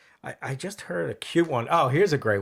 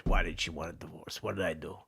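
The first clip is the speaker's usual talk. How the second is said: low, soft, put-upon husband; comic low-voiced Italian man; old Italian husband; 7 semitones lower, 7 dB quieter than usual. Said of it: "brings the guy in".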